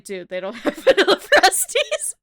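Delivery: giggling through the punchline; can barely get it out for laughing; laughing quote. Retold or imitated. Laughing as she quotes the uncle reporting back to dad. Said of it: "He's like".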